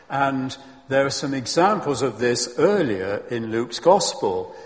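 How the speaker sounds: usual speaking voice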